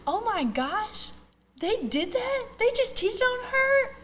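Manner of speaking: put-on shocked boyfriend. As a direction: act out his outrage over the show's cheating. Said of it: "he was like".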